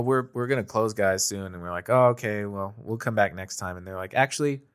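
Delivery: usual speaking voice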